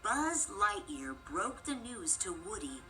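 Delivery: ordinary delivery, nothing marked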